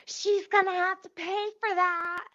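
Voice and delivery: high-pitched mock objection; Jamaican coworker. Coworker behind server demanding payment, mocking imitation. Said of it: "this big Jamaican bitch behind her".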